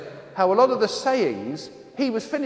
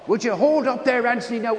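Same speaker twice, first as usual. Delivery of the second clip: high-pitched mock protest from a nervous passenger; comic raised-pitch fretting; imitating alarmed father; 5 semitones higher than usual. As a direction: act out a panicky dad in the car.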